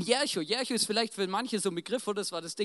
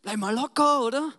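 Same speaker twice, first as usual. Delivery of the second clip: mildly irritated telling-off, a bit raised; annoyed shushing; 4 semitones higher, 4 dB louder than usual. Acted. Disciples hush the shouting beggar, mildly annoyed. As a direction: tell a noisy man to calm down. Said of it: "Gehen zu ihm hin und sagen".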